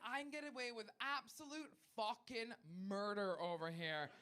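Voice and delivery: usual speaking voice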